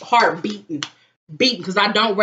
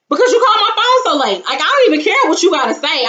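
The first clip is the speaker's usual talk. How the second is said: fast high rant; 7 semitones higher, 3 dB louder, faster than usual. Retold or imitated. Ranting at the late caller.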